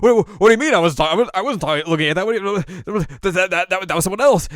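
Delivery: loud, high, stammering protest; flustered denial. Retold or imitated. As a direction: sputter a mock-panicked denial at full volume. Acted flustered denial, caught looking.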